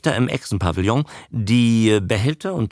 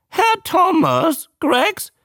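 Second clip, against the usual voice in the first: very high, slow squawk; drawn-out high creature question; newt repeating; 4 dB louder, slower than usual. A narrator's reading. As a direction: parrot the name back in newt voice. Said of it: "wiederholte der Molch".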